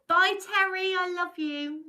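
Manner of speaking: loud, high, gushing goodbye; effusive farewell. Real, not acted. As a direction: sing out your love as they leave. Calling goodbye to departing viewers.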